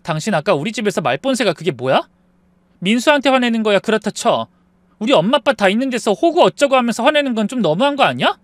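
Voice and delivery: high, loud indignant scolding, building; angry wife. Acted. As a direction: tear into your husband, voice climbing. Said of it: "무섭게 화를 내더군요".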